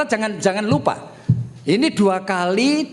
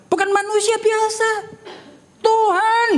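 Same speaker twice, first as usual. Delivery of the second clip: emphatic exclamation; 13 semitones higher than usual. Real, not acted. Preacher exclaims who prayed.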